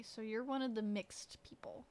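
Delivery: ordinary talk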